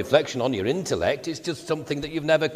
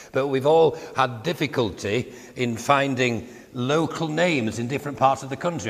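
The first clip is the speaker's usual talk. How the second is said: usual speaking voice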